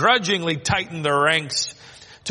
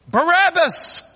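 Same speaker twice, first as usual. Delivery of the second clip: high shouted name like a mob; crowd screaming; 14 semitones higher, 4 dB louder than usual. Retold or imitated. Crowd screams the prisoner's name, loud. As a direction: yell it as the crowd would. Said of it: "heard the crowd scream his name".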